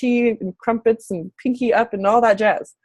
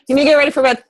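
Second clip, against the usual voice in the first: louder and higher, calling across the room; firm call to son; 4 semitones higher, 4 dB louder, much faster than usual. Real, not acted. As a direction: mom calling her son to get ready for bed. Turns to tell son bedtime.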